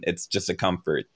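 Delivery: normal talking voice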